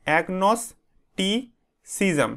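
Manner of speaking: "agnosticism" very slowly in pieces, agnos - ti - cism; broken into syllables. Shown as a demonstration. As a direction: split "agnosticism" with long pauses between the parts. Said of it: "সিলেবেলে ভাগ করে নেবে এটাকে". ("agnosticism" ভেঙে ভেঙে, খুব ধীরে, থেমে থেমে উচ্চারণ)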